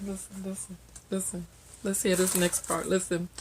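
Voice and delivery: barely voiced hush; soft conspiratorial listen. Real, not acted.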